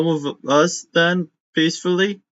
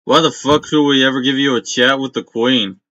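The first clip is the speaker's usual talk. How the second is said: angry guard; 4 dB louder than usual. Acted. Guard gruffly refusing the party.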